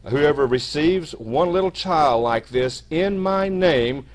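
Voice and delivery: loud, lifted, emphatic Bible reading; scripture reading. Acted. Preacher reads Jesus's lesson on children, loud emphatic scripture reading. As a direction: proclaim the verses, stretching the weighty words.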